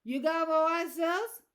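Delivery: slow, loud, slurred Southern question; slurred Southern accent. A narrator's reading. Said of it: "his words slurred like he'd had trouble".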